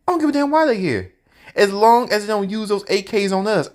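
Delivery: high, fast, worked-up retort; heated rant. Retold or imitated. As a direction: let it fly at Fred. Narrator's heated retort to Fred.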